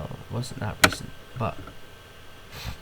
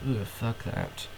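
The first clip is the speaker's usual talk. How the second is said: normal talking voice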